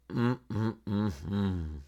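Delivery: put-on an old woman quietly shaking her head; muttered disapproving hum. A narrator's reading. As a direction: hum your disapproval under your breath. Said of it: "the old woman muttered".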